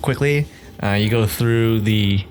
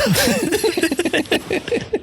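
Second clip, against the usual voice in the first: high squeaky giggle; a bright high-pitched laugh; giggling at his own teasing. Real, not acted.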